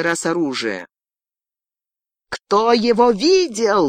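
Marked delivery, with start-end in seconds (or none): 2.3-3.9 high, drawn-out cried demand; crying out. A narrator's reading. Girl interrogating her captive, crying out. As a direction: call the question out with pitch raised, slowly. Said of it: "выкрикнула она".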